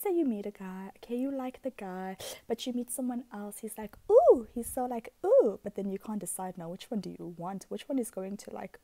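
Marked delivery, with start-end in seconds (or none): 4.1-4.5 high, loud swooning ooh; a hammed-up lovestruck ooh; mock smitten ooh. Imitating a smitten guy. 5.2-5.6 high, loud swooning ooh; a hammed-up lovestruck ooh; mock smitten ooh. Imitating a smitten guy.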